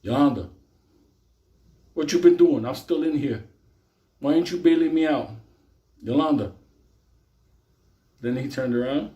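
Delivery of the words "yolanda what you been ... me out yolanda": pleading inmate. Retold or imitated. Inmate pleading on jail phone.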